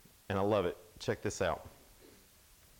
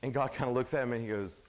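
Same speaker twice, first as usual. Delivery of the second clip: ordinary delivery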